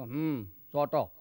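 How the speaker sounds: slow, low drawled village elder; a village sarpanch drawling a welcome; gruff old uncle. Acted. Uncle welcoming the young doctor, slow and low.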